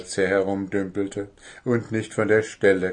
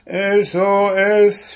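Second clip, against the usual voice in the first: slow, high, loud distress call; shouting; 10 semitones higher, 7 dB louder, much slower than usual. A narrator's reading. Goblin calls for rescue from the boat, shouting. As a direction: shout each letter drawn out. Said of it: "schrie Wuschel".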